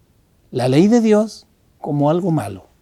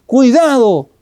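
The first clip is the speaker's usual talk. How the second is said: higher, louder urgent warning; guard's warning call; 6 semitones higher, 4 dB louder than usual. Retold or imitated. Conscience as a guard alerting you to danger: raised urgent call. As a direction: stop someone stepping the wrong way. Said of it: "el guardia que te alerta y te dice".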